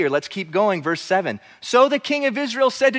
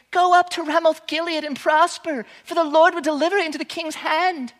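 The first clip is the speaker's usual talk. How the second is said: false prophets cheering; 8 semitones higher than usual. Acted. False prophets urge the king.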